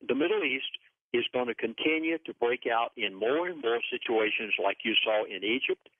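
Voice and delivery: ordinary talk, nothing marked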